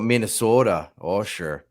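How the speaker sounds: playful put-on Minnesota accent. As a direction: talk like a Minnesota local. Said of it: "she's got the accent".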